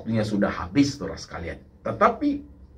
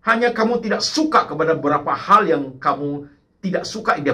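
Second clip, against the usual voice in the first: loud, high-pitched preaching; preaching at full force; 6 semitones higher, 5 dB louder than usual. Acted. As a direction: drive every line home to the congregation. (khotbah berapi-api)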